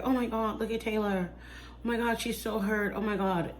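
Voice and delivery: mock the crowd's hushed sympathy; softly mocking onlookers; mock fake sympathy. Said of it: "trying to get people to be like".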